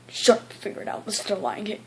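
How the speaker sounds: a forced, breathy hiss of an order; mock teacher telling the kid off in a hiss; harsh whispered order. Teacher refuses help.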